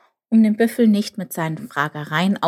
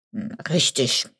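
low, mildly grumbling ox; ox's grumble; 3 semitones lower than usual. A narrator's reading. Ox confirms Chip's guess, low mild grumble. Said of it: "brummte der Ochse".

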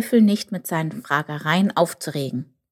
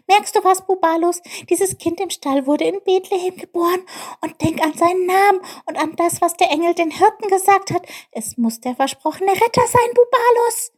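high squeaky little character, excited; Chip, excited; 6 dB louder than usual. Acted. Chip convinces Bubalus about the Saviour, high and excited. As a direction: pile up the proof in an eager high voice. Voices Chip's excited discovery.

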